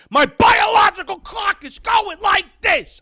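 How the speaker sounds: slow, high, shouted; high slow shouted movie line; Marisa Tomei quote. Retold or imitated. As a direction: stomp it out like an angry girlfriend. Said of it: "It's Marissa Tomei".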